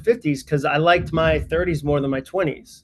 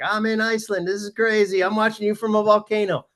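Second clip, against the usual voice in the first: mock excited viewer; 6 semitones higher than usual. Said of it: "but you wanna be like".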